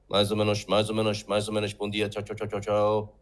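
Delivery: low monotone tuning-up patter; finding the note. Pretend. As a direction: chant it on one low note, like tuning your ear. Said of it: "you put your finger in your ear".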